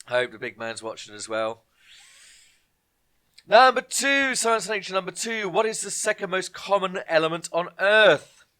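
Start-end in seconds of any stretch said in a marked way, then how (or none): 3.5-8.5 loud, emphatic quiz question read-out; quizmaster announcing. Acted. Quiz host calling the question to the players, loud and emphatic. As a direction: project it like a pub quiz host.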